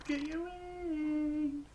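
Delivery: "Got" pitched high and the whole line drawn out slowly; high singsong tease. Real, not acted. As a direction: coo it high and slow at the bird. Playing with the bird.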